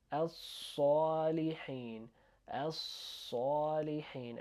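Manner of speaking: "As-salihin" with the s held, then dark "saw"; slow, drawn-out "As-salihin"; doubled heavy S. Shown as a demonstration. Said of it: "And it's doubled".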